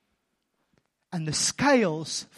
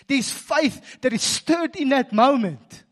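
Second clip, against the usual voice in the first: full-force preaching; 7 semitones higher, 6 dB louder than usual. Acted. Pastor urging the congregation to follow God, full-force preaching. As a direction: drive it home to the congregation.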